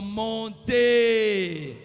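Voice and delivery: high, very loud, drawn-out shout; long ringing cry. Shown as a demonstration. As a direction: shout it out for the crowd to repeat. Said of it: "Non, crie à haute voix".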